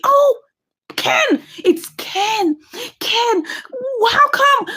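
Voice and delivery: high-pitched gasping surprise; astonished recognition. Acted. Storyteller discovering Ken in the stopped car, high astonishment.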